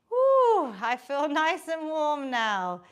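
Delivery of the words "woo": high, loud woo of effort; excited whoop. Real, not acted. Instructor whooping mid-workout, excited. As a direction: let out a bright cheer.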